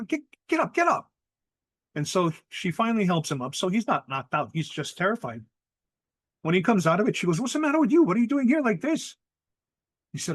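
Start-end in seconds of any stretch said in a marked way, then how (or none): 0.1-1.1 high, urgent wake-up call; retold panicky plea to wake; urgent rousing. Sister finding her brother on the ground, urgent rousing. 7.4-9.2 high, loud, worried scolding; acting out a sister's alarmed demand; alarmed questioning. Sister to brother found on the ground, alarmed questioning.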